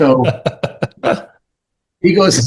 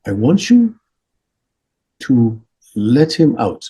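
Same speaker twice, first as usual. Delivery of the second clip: Dalai Lama. Retold or imitated. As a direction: urge it gently, spaced out with pauses. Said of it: "He goes".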